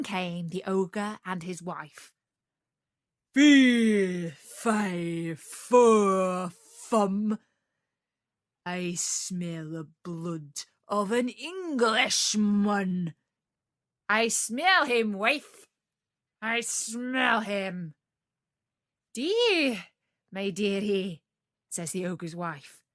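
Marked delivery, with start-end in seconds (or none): 3.3-17.9 slow, loud giant chant; ogre sniffing out Jack. Acted. 19.1-21.2 wife humouring her ogre husband; wife's mock-sweet reply. Wife answers.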